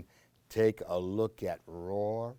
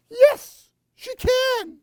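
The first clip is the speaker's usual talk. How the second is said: much higher, loud delighted cry; excited; 12 dB louder, slower than usual. Real, not acted. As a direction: squeal with joy at the reveal. Excited reveal of monster.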